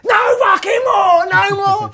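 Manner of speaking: loud high mock yell from a window; neighbour yelling. Angry neighbour shouting down from the flats.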